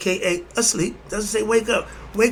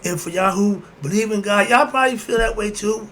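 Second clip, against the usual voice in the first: ordinary talk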